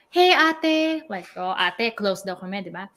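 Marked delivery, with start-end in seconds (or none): 0.1-1.0 higher, louder imitation of an eager caller; imitated fan greeting. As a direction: call out as the young fan. Acting out a young fan's greeting.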